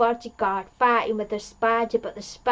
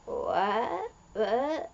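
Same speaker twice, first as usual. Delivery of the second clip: quiet disbelief; 5 dB quieter than usual. Retold or imitated. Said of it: "It's like".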